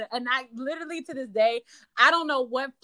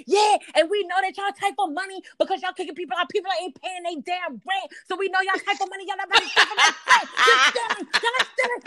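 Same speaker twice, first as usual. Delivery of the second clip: retold angry rant; 6 semitones higher, faster than usual. Friend ranting at the landlady about stealing, heated and rising. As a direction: accuse them of stealing, building to a shout. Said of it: "she's going crazy".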